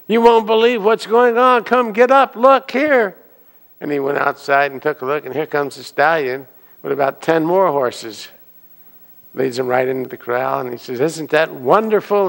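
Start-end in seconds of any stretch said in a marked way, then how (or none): 0.1-3.1 very high, loud, squealing excitement; can't contain the good news; thrilled neighbor. Acted. Neighbor at the door, shouting with glee. 11.0-12.3 delighted neighbor. Acted.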